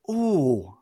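mock dawning realisation, pitch shooting up; acted surprise. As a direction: play the salesperson acting delighted and surprised. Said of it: "They don't bring the actor".

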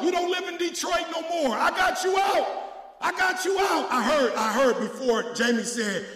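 loud, high shouted preaching; preaching at full force. Acted. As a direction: whip the church up, full voice.

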